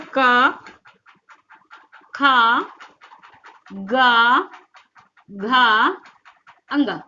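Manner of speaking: क, खा, गा, घा each held long on the vowel; articulation demo. Shown as a demonstration. Teacher showing students the का वर्ग, क, खा, गा, घा stretched out singly. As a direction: draw out क, खा, गा, घा one by one, then a short अंगा. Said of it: "का वर्ग का जो भी उच्चारण स्थान होगा".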